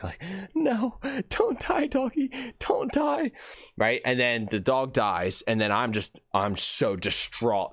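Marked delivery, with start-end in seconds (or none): 0.5-3.3 high, slow melodramatic plea; mock romantic grief. Mailman beside dying dog friend, mock romantic grief. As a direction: wail it like a tragic love scene. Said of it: "I have this little romantic".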